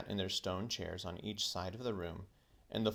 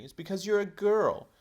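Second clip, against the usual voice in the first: loud, much higher jeer; heated retort; 11 semitones higher, 9 dB louder than usual. Acted. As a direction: fling it at her scornfully.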